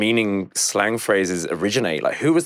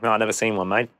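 put-on casual Australian accent, a touch higher; breezy Australian, no worries; an Australian shrugs off spider fears in a laid-back Australian accent; Australian bloke; 4 semitones higher than usual. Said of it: "They'll be like".

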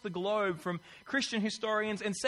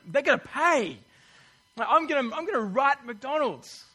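hammed-up louder threat; comic indignation over a wrong order; mock outrage; 5 semitones higher, 5 dB louder than usual. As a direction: play the petty fired-up customer. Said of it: "You get fired up".

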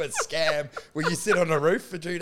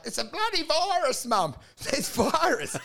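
higher put-on voice, playing innocent; mock innocent teen; 9 semitones higher than usual. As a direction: fake innocence to mum. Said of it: "you're like".

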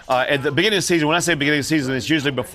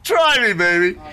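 high, loud playful push; egging her on to tell; eager urging; 10 semitones higher, 4 dB louder, slower than usual. Retold or imitated. Santa urging the mother to share the news, high eager voice.

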